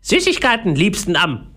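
high, delighted parrot voice; eager parrot. A narrator's reading. Parrot names favourite food, eager high voice. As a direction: relish it like a greedy bird. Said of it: "sagte der Papagei mit leuchtenden Augen".

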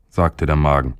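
ordinary delivery, nothing marked

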